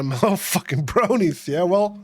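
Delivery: normal talking voice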